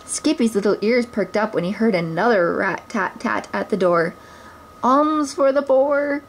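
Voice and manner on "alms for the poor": slow, higher, wavering plea of a poor beggar; beggar's cry. Acted. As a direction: beg passers-by for coins. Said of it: "cried a poor blind beggar man".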